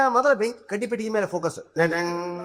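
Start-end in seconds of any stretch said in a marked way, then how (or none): 1.8-2.5 softly voiced "dadang" music sting; mock soap-opera sound effect; imitated suspense sting. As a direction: mimic a TV serial's dramatic sting. Mimics serial's suspense music.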